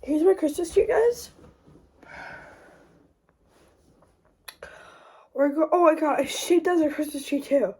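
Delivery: panting after a run; breathless from running upstairs; out of breath. Real, not acted. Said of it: "I'm really out of breath right now".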